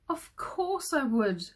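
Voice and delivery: mild weepy king; king cries in despair; crying. A narrator's reading. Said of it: "cried the king".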